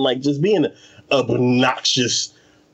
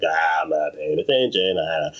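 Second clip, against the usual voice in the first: much lower and softer, mock dopey drone; dopey spirit; 10 semitones lower, 3 dB quieter than usual. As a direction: play the spirit tuning out, low and dull. Dopey spirit voiced in retelling.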